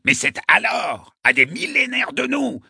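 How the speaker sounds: put-on high-pitched, insistent old man's squawk; yelping. A narrator's reading. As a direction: yelp the demand in a high, impatient voice. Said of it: "glapit-il".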